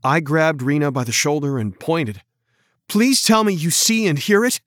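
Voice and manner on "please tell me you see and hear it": higher, urgent pleading; begging. A narrator's reading. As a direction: beg him to believe you. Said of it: "I begged".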